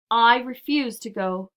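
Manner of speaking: loud, slow, stubborn refusal; cried out. A narrator's reading. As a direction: dig in and declare it slowly and loudly. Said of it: "cried Grandpa George".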